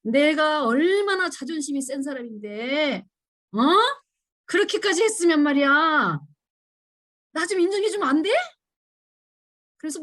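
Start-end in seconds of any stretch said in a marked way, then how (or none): 0.0-8.3 mock angry complaint. To ungrateful people, indignant raised complaint. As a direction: vent the hurt pride angrily. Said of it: "화가 나면서 하는 소리가 뭐예요?".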